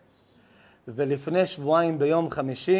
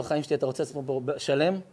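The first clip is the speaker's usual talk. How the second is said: gentle question; 6 semitones lower, 7 dB quieter, faster than usual. Retold or imitated. Rabbi gently questions the man.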